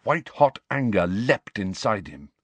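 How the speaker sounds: usual speaking voice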